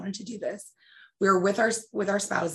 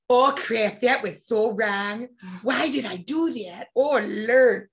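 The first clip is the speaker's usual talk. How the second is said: put-on self-scolding in mother's drawn-out accent; slow, dismayed Midwestern mom voice; Jackie; 2 semitones higher, much slower than usual. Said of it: "I think to myself".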